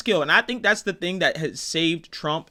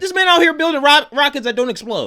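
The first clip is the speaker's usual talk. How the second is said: higher and louder, amazed; excited; 11 semitones higher, 7 dB louder than usual. Real, not acted. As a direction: gush about the rockets. Excited about Elon Musk.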